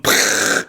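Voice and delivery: unvoiced lip puff for a blast; pfft explosion noise. City blowing up, mock pfft explosion sound. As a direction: puff air through the lips like a tiny explosion. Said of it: "explodes the Terran Mist going".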